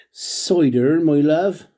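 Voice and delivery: mock New Zealand accent. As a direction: put on a playful New Zealand accent, as a Kiwi would say it. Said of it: "they must say cider over there like that".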